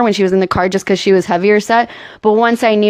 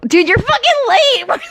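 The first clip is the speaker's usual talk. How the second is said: high-pitched jeering yell; mock taunting shout; 12 semitones higher than usual. Mock taunting over spoilers.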